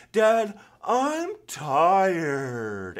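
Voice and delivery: put-on slow drawn-out whine of a worn-out little boy; whiny tired kid. Son whining to dad after a long day, slow whiny voice. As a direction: whine it out like an exhausted five-year-old. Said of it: "in a really whiny voice".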